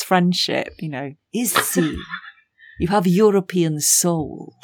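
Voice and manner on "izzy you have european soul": slow, intense Slovenian-accented address. Shown as a demonstration. Imitating an intense friend addressing Izzy, Slovenian accent. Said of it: "I'm basing it on my friend".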